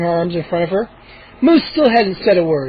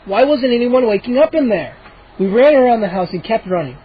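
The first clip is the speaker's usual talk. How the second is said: ordinary delivery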